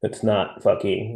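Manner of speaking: ordinary talk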